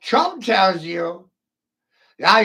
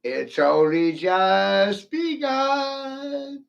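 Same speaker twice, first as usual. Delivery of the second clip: slow drawn-out; much slower than usual. Pretend.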